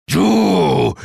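loud, high-pitched snarling outburst; throaty growl. A narrator's reading. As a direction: growl it like a rival to any werewolf. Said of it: "knurrte ich aus tiefster Kehle".

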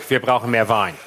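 a hint of Steinbrück, low; Steinbrück impression. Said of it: "Den Satz habe ich von Steinbrück".

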